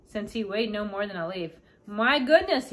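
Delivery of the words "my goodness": loud, high startled cry; a sudden shocked gasp of words. Acted. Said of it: "he gasped".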